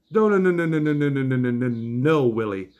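rapid refusal. Retold or imitated. Husband warning Willie off his wife, rattled-off objection. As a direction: cut him off with a descending string of no's.

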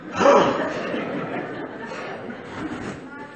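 long unvoiced put-upon sigh, ending in a laugh; exasperated parent sigh. Parent asked to clean the dishwasher, exasperated sigh. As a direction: heave a weary sigh at the chore. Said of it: "Parents, you know that sound".

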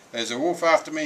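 high, loud storybook panic; gasped alarm. A narrator's reading. Fleeing pig warns his brother, gasping in alarm. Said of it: "he gasped".

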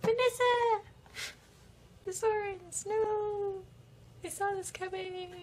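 high pitched horrified wail; anguished cry. Acted. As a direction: cry out in horror. Townsfolk crying out in horror.

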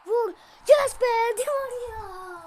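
loud, high, slow triumphant yell; goal celebration. Real, not acted. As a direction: scream the goal with joy.

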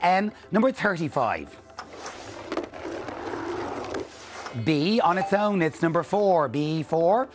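slow, measured bingo calls; bingo caller. Acted. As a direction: call each number out clearly with pauses.

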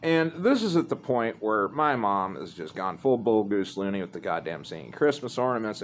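ordinary delivery, nothing marked

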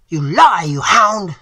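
high, loud furious scream. A narrator's reading. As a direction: scream the insult in rage. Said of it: "screamed Hartman".

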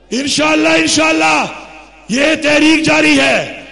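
loud, high-pitched political oratory; fiery rally speech. Real, not acted. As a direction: address the crowd at full force, phrase by phrase. (جلسے میں پُرجوش، للکارتی تقریر)

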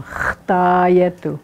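"Chtayetu" with a held throat fricative on "Ch"; "Chtayetu", long rasped onset and stretched first vowel; guttural opening sound. Shown as a demonstration. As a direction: exaggerate the back-of-throat "Ch" in "Chtayetu". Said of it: "Where's that sound coming from".

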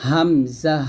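হামজা started in the throat, long syllables; Hamza from the throat. Shown as a demonstration. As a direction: bring হামজা out slowly from the throat. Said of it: "কণ্ঠনালীর শুরু থেকে এই হামজাটা".

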